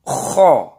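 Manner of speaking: "Kho" rasped loud from the throat; practice kho. Shown as a demonstration. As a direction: model the throat kho firmly for students. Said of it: "Kita praktekkan".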